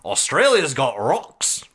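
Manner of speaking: put-on Australian accent on one phrase. Shown as a demonstration. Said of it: "The only phrase I can say with an Australian accent".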